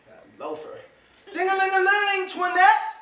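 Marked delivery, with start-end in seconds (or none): 1.3-2.9 higher, louder sing-song bell call. Acted. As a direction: ring for the maid with your voice. Calling servant impatiently.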